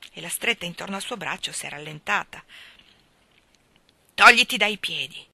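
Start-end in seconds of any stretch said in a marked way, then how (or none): 4.2-5.2 furious. A narrator's reading. Father raging at his daughter to get off him. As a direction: bellow her away. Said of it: "disse Tulliver rabbiosamente".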